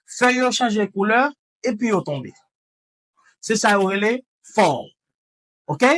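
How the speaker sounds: ordinary talk, nothing marked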